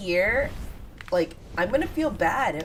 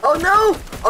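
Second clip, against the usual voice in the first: high, loud cry of alarm; alarmed shout; 11 semitones higher, 10 dB louder than usual. Real, not acted. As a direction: shout it in a sudden panic. Her brother's player is spotted.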